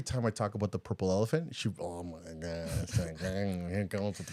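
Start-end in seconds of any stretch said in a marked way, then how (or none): 1.8-4.3 low, muttered imitation of a weary complaint; imitated under-the-breath groaning complaint; mock exasperation. Imitating colleague's exasperated reaction.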